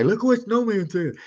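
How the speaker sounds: high, gloating mock voice of a fan who clipped it. Mocking the listeners who'd clip his words, a high gloating taunt. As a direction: sneer it like you caught him out. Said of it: "clip that and play that and say".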